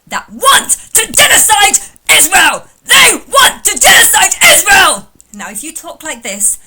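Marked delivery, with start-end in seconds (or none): 0.1-5.1 building into a loud mock yell; mock furious rant. Throwing the caller's words back, mock shouting. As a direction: ramp up into a parody rant. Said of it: "I can talk like this too".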